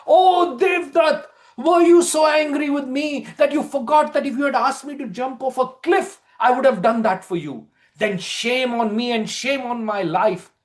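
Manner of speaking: high, loud grieving cry; anguished lament. Acted. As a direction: wail over your dead friend.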